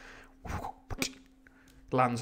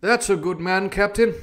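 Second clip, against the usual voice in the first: high, loud character voice; doctor addressing captain; 7 semitones higher, 9 dB louder than usual. A narrator's reading. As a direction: speak up as the doctor, high-pitched. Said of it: "said the doctor".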